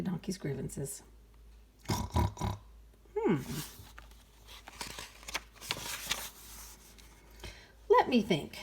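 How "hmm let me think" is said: slow thoughtful hum; lightly voiced pig thinking. Mother pig Rosa pondering, a slow hum. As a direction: mull it over like a sleepy pig. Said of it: "she said".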